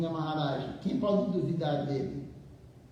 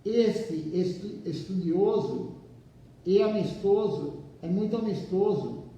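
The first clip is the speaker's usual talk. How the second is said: slow, measured reading with pauses between phrases; leitura de livro em voz alta; slower than usual. Acted. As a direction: read the text out deliberately, phrase by phrase. Reads the book aloud to listeners. (leitura pausada, frase por frase)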